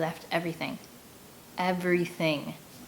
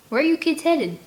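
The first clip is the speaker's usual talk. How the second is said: higher, louder friendly call across the water; teen boy asking; 9 semitones higher, 5 dB louder than usual. A narrator's reading. Older boy calling to kids across the river, friendly. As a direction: call over the river, curious. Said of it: "Edge asks".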